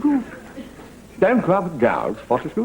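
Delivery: hammed-up officer's disbelief; commanding officer. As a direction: query it like a baffled British CO. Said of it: "people who talk like that".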